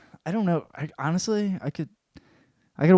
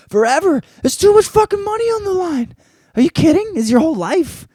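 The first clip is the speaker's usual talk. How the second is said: high, loud, exasperated outburst; incredulous rant; 6 dB louder than usual. Acted. Fan urging fighter to take the title, loud incredulous rant. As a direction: shout it high, in disbelief.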